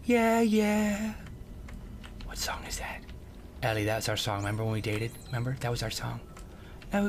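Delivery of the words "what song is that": hushed question; whispering. Real, not acted. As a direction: whisper it to yourself. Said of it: "unvoiced whisper".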